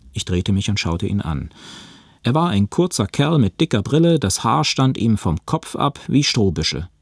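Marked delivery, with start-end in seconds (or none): none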